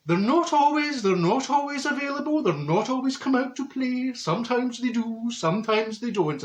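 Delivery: a fake psychic smoothly explaining; mock psychic excuse.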